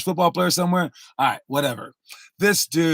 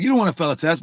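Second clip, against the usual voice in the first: ordinary talk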